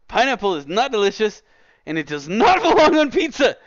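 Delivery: loud, high, laughing through the outrage; exasperated laughing rant. Real, not acted. Streamer ranting at chat about pineapple pizza, laughing shout. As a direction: shout your outrage but crack up.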